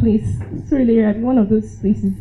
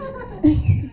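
quick high giggle; a short high laugh; 13 semitones higher, 4 dB quieter than usual. Real, not acted. After scolding him about drinks, quick giggle.

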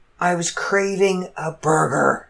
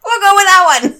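mock cheerful choice; 11 semitones higher, 8 dB louder than usual. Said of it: "I'm like".

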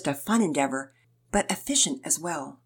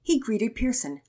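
plain reading voice

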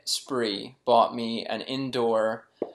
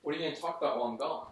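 walking away; 9 dB quieter, much faster than usual. Real, not acted. Walking off to fetch ball.

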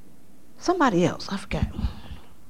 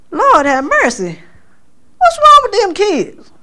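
alarmed exclamation; 11 semitones higher than usual. Retold or imitated. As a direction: exclaim in alarm, rising high. Alarmed at dream meaning.

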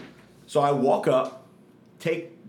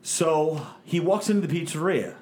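ordinary talk

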